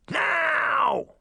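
high, drawn-out shouted command; princess's roar. A narrator's reading. Princess orders her servant to hurry, high roar. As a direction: shriek the order as a spoiled royal. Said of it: "she roared".

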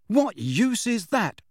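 student loudly questioning the teacher; loud challenge. Acted. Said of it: "said Harry loudly".

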